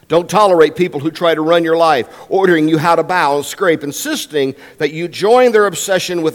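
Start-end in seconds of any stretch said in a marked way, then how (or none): none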